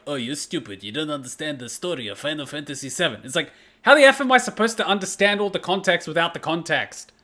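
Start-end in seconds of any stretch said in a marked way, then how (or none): 0.1-3.2 mocking critics. Fans scolding a newcomer for missing the story, mocking imitation. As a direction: lower mock voice of know-it-all fans. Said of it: "some people were like".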